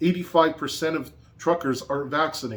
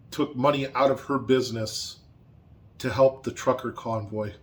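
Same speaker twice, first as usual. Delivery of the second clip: normal talking voice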